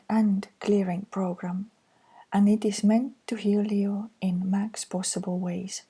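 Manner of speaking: ordinary talk, nothing marked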